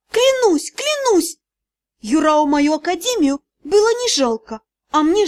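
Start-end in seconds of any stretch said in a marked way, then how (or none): 0.1-1.4 very high, insistent cry; desperate vow. A narrator's reading. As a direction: swear it over and over, desperately. Desperately swearing to the sea god. (отчаянная высокая клятва)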